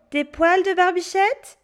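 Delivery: put-on high, cheeky child; a boy teasing the witch. Pierre lists ingredients.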